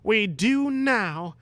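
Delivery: rallying call, slowed right down. Acted. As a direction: lead them like a champion. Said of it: "stood up bravely".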